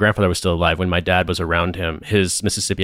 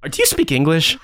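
higher, rising, mock-baffled question; retold incredulous question, pitched up; baffled retort; 8 semitones higher than usual. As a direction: ask it as if you understood nothing. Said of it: "I was just like".

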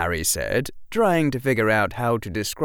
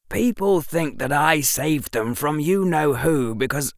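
put-on higher-pitched boy, annoyed; boy hero, frustrated; 8 semitones higher, 3 dB louder than usual. A narrator's reading. Boy explaining his fame to the professor, frustrated.

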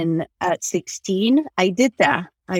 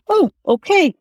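mock dismissive okay; 9 semitones higher, 4 dB louder than usual. Mimicking dismissive people.

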